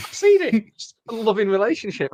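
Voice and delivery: mock outrage. Mock outrage at a crude joke.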